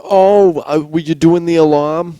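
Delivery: high, loud mocking girl voice; hammed-up high chirpy voice; mock New York girl. Friend imitating a New York girl, high and mocking.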